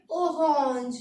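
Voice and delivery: ओहोंज with a breathed French r and nasal vowel; French pronunciation of ओहोंज; French orange. Shown as a demonstration. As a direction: say ओहोंज as o-honzh. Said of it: "इसको पढ़ेंगे".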